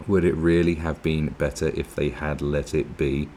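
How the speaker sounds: ordinary talk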